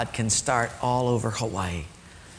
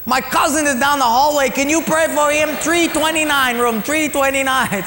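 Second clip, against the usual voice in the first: higher and louder, building excitement; excited telling; 9 semitones higher, 5 dB louder than usual. Real, not acted. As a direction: tell it with rising delight, loud and high. Excited retelling of hospital chain.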